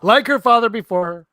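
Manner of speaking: high, loud grand delivery; dramatic hammy repeat. Acted. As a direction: ham up the epic line.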